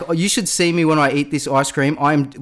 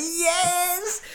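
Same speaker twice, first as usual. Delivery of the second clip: high, gleeful cheer; excited cheer; 6 dB quieter than usual. Retold or imitated. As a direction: cheer like the winner. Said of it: "She's like".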